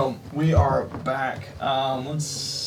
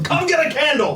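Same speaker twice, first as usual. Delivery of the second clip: high, loud sales call across a room; shouting; 12 semitones higher, 3 dB louder than usual. Retold or imitated. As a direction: holler the offer at passersby. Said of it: "We just shout at people".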